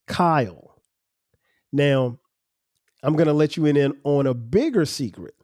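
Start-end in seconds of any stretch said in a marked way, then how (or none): 0.1-0.8 Kyle said as a two-syllable word, KY-ull; correct pronunciation. Shown as a demonstration. Host tells listeners the right way, Kyle in two syllables. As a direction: draw Kyle out into two beats, KY then ull. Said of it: "My name is pronounced".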